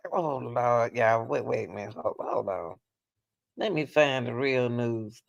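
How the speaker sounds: normal talking voice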